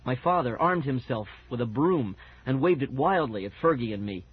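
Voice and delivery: ordinary delivery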